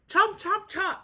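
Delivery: high, loud chopping sounds; teacher hamming up axe chomps. A narrator's reading. Axe blows in story.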